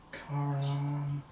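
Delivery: soft, absent-minded repetition while searching; muttering to self. Real, not acted. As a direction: murmur it as you scan the page. Searches rule book, muttering to self.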